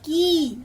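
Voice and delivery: the long ई in की drawn out and loud. Shown as a demonstration. Teacher to children, drawing out की loud and long. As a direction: stretch की out, loud and firm. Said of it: "इसे हम जोर से और थोड़ा लंबा पढ़ेंगे".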